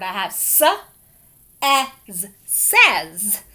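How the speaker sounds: "s, as, says" built up sound by sound; blending. Shown as a demonstration. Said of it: "eh sound in this word".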